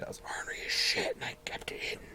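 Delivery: hushed, secretive whisper; whispered aside. Real, not acted. Admits to the listener he hid his ornery side, whispering. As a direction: confide it in a whisper.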